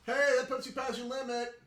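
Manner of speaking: high, loud bartender voice; bartender calling out. Acted. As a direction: holler it as a warning across the bar. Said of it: "you hear the bartender go".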